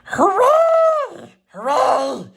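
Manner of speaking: very high, loud hooray; cheering. A narrator's reading. Clown and toys cheer the engine, high and loud. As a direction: cheer with all you've got. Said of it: "cried the funny little clown".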